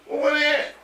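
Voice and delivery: high-pitched eager imitation; eager retold question. Friend asking where the critters are, eager. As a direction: pipe up high, ready to act.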